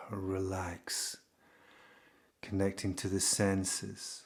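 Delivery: calm, unhurried delivery with long pauses; guided meditation. Real, not acted.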